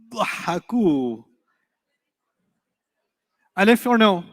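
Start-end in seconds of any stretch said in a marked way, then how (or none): 0.1-1.2 slow pronunciation. Shown as a demonstration. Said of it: "I'd love to hear you say this".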